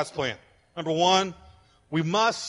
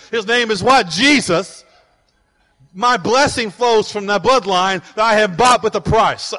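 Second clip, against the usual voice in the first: loud, driving proclamation, slowing on the last words; full-force preaching; 3 semitones higher, 8 dB louder than usual. Acted. Pastor to congregation, loud driving preaching. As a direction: preach it out with rising fire.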